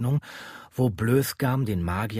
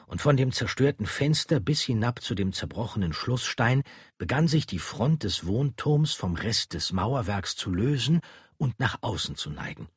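usual speaking voice

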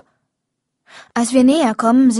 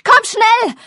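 loud, high yell for Pa; desperate yell for father; 12 semitones higher, 6 dB louder than usual. A narrator's reading. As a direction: scream across the yard for help. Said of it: "brüll ich".

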